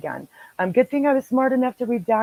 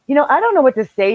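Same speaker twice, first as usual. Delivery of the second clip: louder, higher, fast exasperation; exasperated; 8 semitones higher, 7 dB louder, faster than usual. Real, not acted. Telling listeners about the scam, rushed and exasperated. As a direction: throw up your hands at the listeners. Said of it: "same words said twice".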